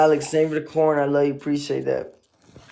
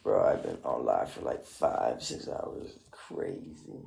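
tired murmur; 14 semitones lower, 9 dB quieter, slower than usual. Real, not acted. Worn out after hours live.

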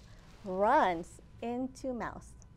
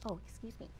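ordinary delivery, nothing marked

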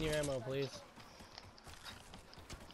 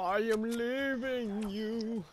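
dramatic plea; 7 semitones higher, much slower than usual. Pretend. Dramatic game banter.

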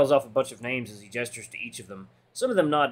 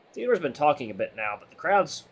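usual speaking voice

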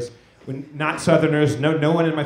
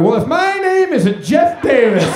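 high, slow, loud, laughing drawled boast; mock southern boast; 11 semitones higher, 8 dB louder, slower than usual. Imagining himself in Louisiana, a hammed-up slow boast. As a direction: ham up a proud Southern claim. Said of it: "I'm like".

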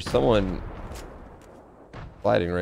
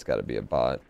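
much lower, deep mock voice; 9 semitones lower than usual. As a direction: drop into a deep voice. Joking deep voice.

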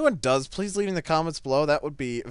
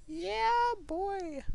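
very high, soft squeal; sing-out in a tiny high voice; silly falsetto cheer; 8 dB quieter than usual. Pretend. Gamer cheering himself on, silly falsetto.

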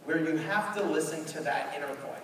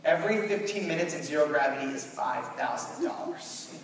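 ordinary talk, nothing marked

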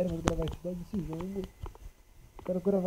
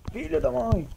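loud, high cursing yell; frightened angry shout; shouted curse; 7 semitones higher, 7 dB louder, slower than usual. Real, not acted. Cursing after scare.

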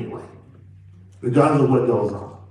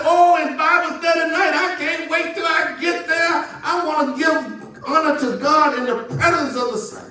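sarcastic over-the-top excitement; mock excited churchgoer; 12 semitones higher than usual. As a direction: ham up the overeager churchgoer, half-sung. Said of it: "You weren't excited and said".

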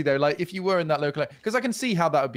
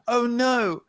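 high, louder mock-horrified cry; mock children's cry; 8 semitones higher, 4 dB louder than usual. Podcaster imagining village children seeing Gimp Man, mock alarm. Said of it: "cried the children".

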